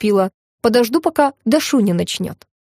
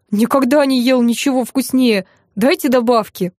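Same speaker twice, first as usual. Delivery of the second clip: plain reading voice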